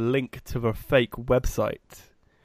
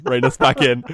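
mock alarm call, pushed louder; louder inner voice alarm; 5 dB louder than usual. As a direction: repeat it like a warning siren. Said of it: "in my head, like, flashing light of".

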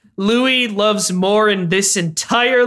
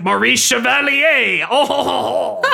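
exaggerated French chef accent; 5 semitones higher, slower than usual. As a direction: ham up a bad French accent. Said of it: "hilariously bad French accent".

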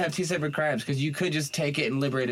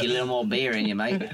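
a light Australian twang on mate; a hint of an Australian bloke offering beer; mock Australian accent. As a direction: play an Aussie judge, matey. Continuing Australian judge bit.

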